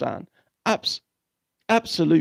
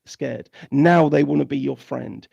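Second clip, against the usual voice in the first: normal talking voice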